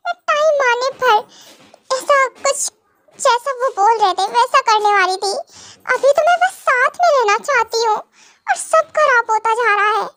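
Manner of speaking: acted helpless distress; distressed lament. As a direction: explain yourself as things fall apart. Wife laments everything going wrong.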